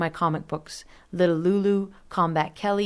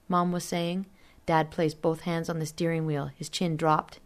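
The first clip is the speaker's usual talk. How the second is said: usual speaking voice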